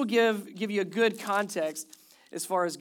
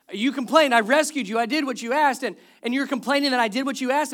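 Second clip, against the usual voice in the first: loud, raised-pitch indignant retort; indignant God; 4 semitones higher, 7 dB louder than usual. Acted. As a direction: throw it back at the complainers, incredulous.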